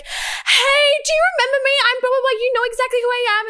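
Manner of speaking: very high, squealy gushing girl imitation; mocking gushy girl greeting; mock fangirl. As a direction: put on a high, loud, overfriendly squeal. Mimicking girls greeting her boyfriend.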